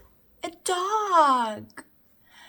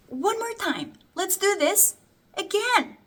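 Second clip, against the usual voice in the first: normal talking voice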